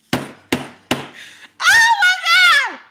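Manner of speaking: high shrieking oh my God; a real startled cry, very high and loud; shocked shriek. Real, not acted. Shocked outburst at own words.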